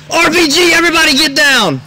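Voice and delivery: very loud, high screamed warning; urgent all-out battlefield scream; pilot's yell. Acted. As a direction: holler for everyone to take cover. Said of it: "and the pilot again yelled".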